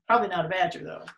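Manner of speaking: ordinary delivery, nothing marked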